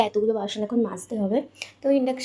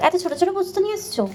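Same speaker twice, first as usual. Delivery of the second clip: surprised; 8 semitones higher, faster than usual. Real, not acted. Husband bought tiny poppy seeds.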